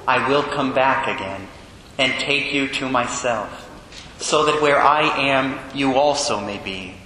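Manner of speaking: low, solemn, measured liturgical proclamation; Gospel reading. Acted. Priest reads the Gospel to the congregation, solemn and low.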